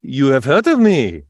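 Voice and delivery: ordinary talk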